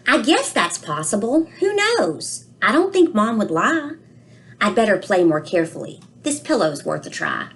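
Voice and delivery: put-on a child's worried storybook voice, read aloud gently; reading to children. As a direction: read it to the class like a puzzled little boy. Reads book aloud, child narrator.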